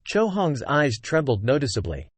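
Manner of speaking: ordinary delivery, nothing marked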